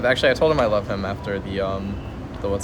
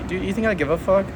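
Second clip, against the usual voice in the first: incredulous; 9 semitones higher than usual. Real, not acted. Friend warns about dirty pants.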